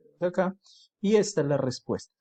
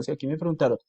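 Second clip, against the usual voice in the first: ordinary talk, nothing marked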